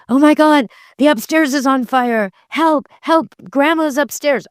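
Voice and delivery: high-pitched mock panic; freaking out. Shown as a demonstration. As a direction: act out a panicked cry for help. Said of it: "and freaking out excited".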